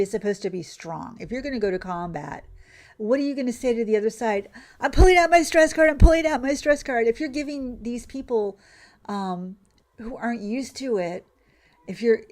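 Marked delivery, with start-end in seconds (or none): 4.8-7.1 loud, high mocking call; mock panicked announcement. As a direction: call it out like a helpless recruit in battle. Mocks recruit calling out in combat.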